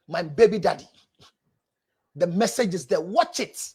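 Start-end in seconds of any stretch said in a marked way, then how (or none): none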